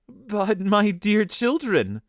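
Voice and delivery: high, slow motherly disbelief; astonished. A narrator's reading. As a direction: stretch it out, pitched high in amazement. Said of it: "said the mother in astonishment".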